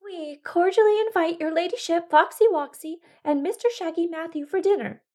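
high, nervous little voice; nervous rabbit. Acted. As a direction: call out nervously like a timid rabbit. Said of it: "called through the window nervously".

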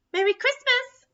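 high squeaky mouse greeting; mouse calling. Acted. Little mouse calling a greeting to the captain, high and bright. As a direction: call out cheerfully, way up high. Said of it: "called the mouse".

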